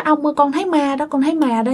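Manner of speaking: put-on high little-kid insistence; small child. A narrator's reading. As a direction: insist to grandpa like a young child. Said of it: "Do bạn còn nhỏ, nó nói". (giọng bé nhỏ mách ông)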